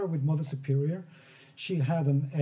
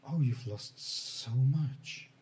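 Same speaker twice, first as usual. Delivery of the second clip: put-on gentle old woman; 3 semitones lower than usual. Old woman gently comforting the grieving man. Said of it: "touches you very gently".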